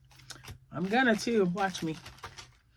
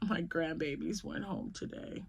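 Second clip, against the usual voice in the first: whispered aside; 9 dB quieter than usual. Real, not acted. Telling viewers a private news.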